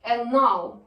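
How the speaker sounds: normal talking voice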